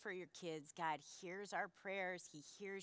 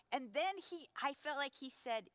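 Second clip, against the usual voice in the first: ordinary talk, nothing marked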